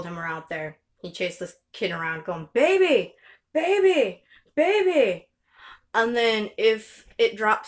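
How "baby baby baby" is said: put-on high, loud toddler calling baby; imitating toddler. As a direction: mimic a three-year-old chasing a baby. Mom imitates son chasing baby.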